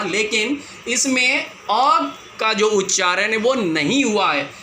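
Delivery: usual speaking voice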